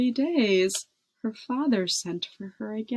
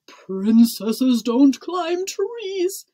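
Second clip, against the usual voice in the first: slow, loud, raised proclamation; thundering; 5 semitones higher, 7 dB louder, much slower than usual. A narrator's reading. King scolding princess about tree climbing, thundering. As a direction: boom each word out slowly and higher. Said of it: "thundered the king".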